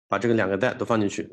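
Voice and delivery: normal talking voice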